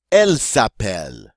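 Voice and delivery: teacher modelling "Elle s'appelle" for the class to copy; French model phrase. Shown as a demonstration. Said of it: "Her name is".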